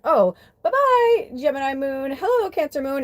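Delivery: playful high sing-song; sing-song goodbye. Pretend. Mock farewell to the moon.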